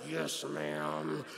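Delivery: low, subdued imitation of an obedient teen; respectful son. Mike answering his fussing mother, respectful and subdued. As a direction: answer mom low and dutifully. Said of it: "one of the most respectful children".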